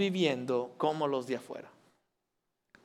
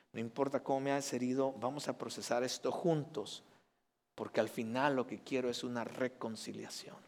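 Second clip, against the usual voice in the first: tender, soft forgiveness; 3 semitones lower, 9 dB quieter than usual. Acted. Husband voices forgiving words to wife.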